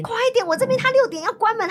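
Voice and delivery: high-pitched retold panic, hurrying someone along; frantic urging. Customer rushing friend because shop closes at six, frantic urging. Retelling her urgent phone plea.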